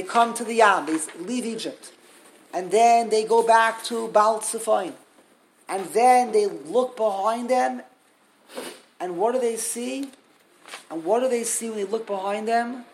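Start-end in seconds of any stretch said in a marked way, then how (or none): none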